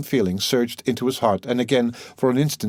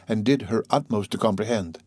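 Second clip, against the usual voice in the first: ordinary delivery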